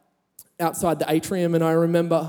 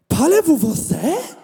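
much higher, delighted French, with a laugh in it; eager French question; 10 semitones higher, 4 dB louder than usual. Retold or imitated. Youth leader greeting the French boy, eager and delighted. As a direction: burst out in French, thrilled to find a speaker. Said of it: "this youth leader goes".

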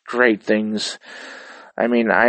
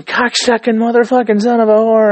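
much higher, louder mock rage; imitating girlfriend raging; 12 semitones higher, 5 dB louder than usual. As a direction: ham up a gamer yelling at the screen. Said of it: "she'll be cursing".

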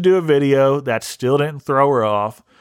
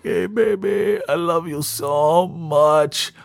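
sleepy catfish voice; 5 semitones higher, slower than usual. Retold or imitated. The catfish greeting Priscilla, mock-sultry croon. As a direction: croon it like a tired online lover. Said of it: "He's like".

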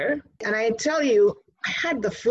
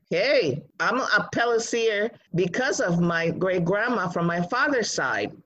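usual speaking voice

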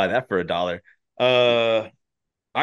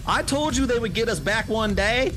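much higher put-on scolding voice; Veronica scolding; 13 semitones higher than usual. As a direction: blame him for the disaster. Veronica blaming Kyle.

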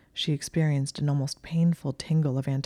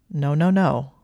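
louder, hurried string of refusals; urgent refusal; 7 dB louder than usual. A narrator's reading. Malfoy immediately stopping Granger, louder urgent refusal. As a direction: cut in firmly and louder.

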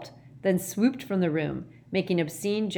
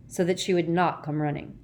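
ordinary delivery

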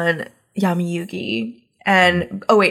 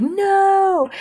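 high, drawn-out "no"; mock dismay; 11 semitones higher than usual. Fan reliving the card reveal, mock dismay. Retold horrified reaction to the card.

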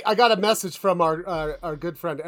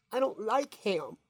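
lightly voiced kid, quieter; a touch of a kid saying no; kid refusing; 2 semitones higher, 10 dB quieter than usual. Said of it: "And the kid goes".

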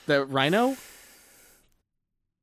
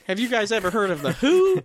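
mock eager teacher; 6 semitones higher, 4 dB louder than usual.

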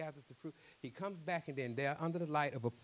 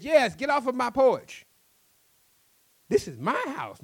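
angry shout; 12 semitones higher, 11 dB louder than usual. Acted. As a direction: shout him off the porch. Angry argument over house.

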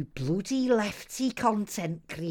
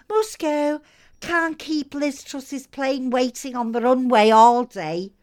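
ordinary talk, nothing marked

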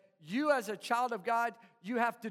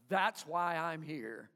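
quiet realization; 7 semitones lower, 3 dB quieter than usual. Acted. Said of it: "and they're like".

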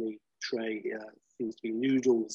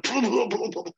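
mock spluttering; 10 semitones higher, 4 dB louder than usual. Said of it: "but you're like".

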